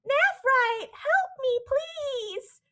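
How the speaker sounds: very high mock cry for help in a Brooklyn accent; weird surreal voice. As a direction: imitate an eerie, high-pitched damsel calling out. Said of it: "we hear in a weird, echoey, surreal voice".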